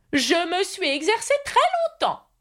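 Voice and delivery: high-pitched, stubborn knight repeating himself; insistent knight. A narrator's reading. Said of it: "répéta-t-il sans arrêt". (chevalier obstiné, voix haut perchée)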